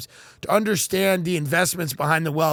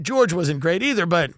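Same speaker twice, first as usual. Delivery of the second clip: ordinary delivery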